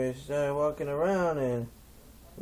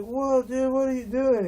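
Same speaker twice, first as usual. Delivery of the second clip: high, loud panicked yell; alarmed yell; 13 semitones higher, 7 dB louder than usual. Retold or imitated. Stoner yells at the chief, high and loud.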